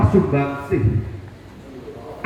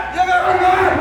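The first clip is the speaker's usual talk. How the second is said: rallying shout; 11 semitones higher than usual. Real, not acted. Protest speech rallying crowd.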